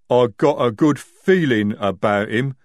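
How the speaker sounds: loud, lumbering troll voice; slow-witted troll. A narrator's reading. Jade the troll telling of a fight, lumbering. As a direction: plod heavily through the confession.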